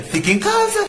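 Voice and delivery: high, fed-up jab; exasperated retort. Retold or imitated. Speaker to picky classmate, high and fed up. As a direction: snap it at her, exasperated. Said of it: "Dá vontade de falar".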